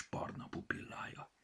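plain reading voice

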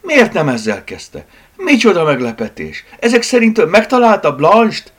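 loud, high, eager questioning; excited outburst. Acted. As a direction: build to a high, hopeful cry. Hopeful outburst after the shouted realisation.

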